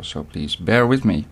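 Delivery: normal talking voice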